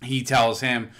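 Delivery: normal talking voice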